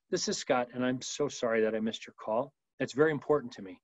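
soft, low, heartfelt apology; calm, tender voicemail greeting; caring Earth Green voicemail. Shown as a demonstration. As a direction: connect gently and sincerely. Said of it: "Earth Green. Make a connection".

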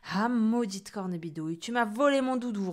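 loud angry grumble; grumbling through teeth. Acted. Boy cursing the witch who stole his doudou, grumbling. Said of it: "ronchonna Pierre en serrant les dents".

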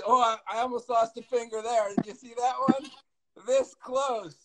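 excited yell after a near miss; real yelling. Real, not acted. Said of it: "I'm yelling".

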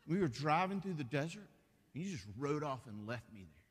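a hint of a wounded wife telling her dream; wife woken from a dream, lightly voiced and hurt; sleepy wife recounting dream. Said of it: "She said".

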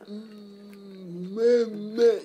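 stuck on a word with a swollen tongue; tongue-tied. Shown as a demonstration. Actor showing the struggle with certain words, tongue-tied. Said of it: "Your tongue gets three times the size".

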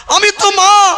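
high, loud wailing cry; wailing plea. Acted. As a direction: lift it into a sung lament. Mother pleads with God.

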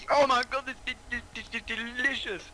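mock delight. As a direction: squeal over the first taste. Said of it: "And I was like".

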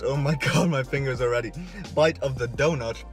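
usual speaking voice

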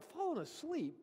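high, disbelieving echo of Fallen asleep; incredulous. Retold or imitated. Disciples echoing Jesus in disbelief, high incredulous question. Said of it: "the disciples are like".

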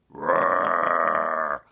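loud growling bear roar; hammed-up storybook bear roar. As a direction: roar like a big bear to spook the kids. Bear appears in the garden.

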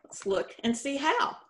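normal talking voice

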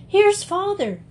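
put-on high, eager cry of the children; excited. A narrator's reading. Children hearing Father's gate, excited cry. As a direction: call out in sudden excitement.

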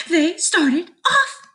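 usual speaking voice

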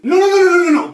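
high, loud string of noes; urgent objection. Pretend. Enrique overruling the birds' plan, urgent objection. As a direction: cut them off with rapid noes.

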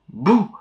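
spooky boo. Acted. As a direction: jump out with a boo. Scaring children.

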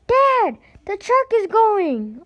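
put-on very high, loud boy's cry; alarmed kid shout. Boy calling to his dad as the truck leaves, high alarmed shout.

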